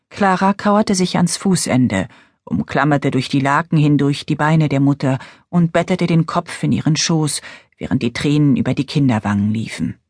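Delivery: ordinary delivery, nothing marked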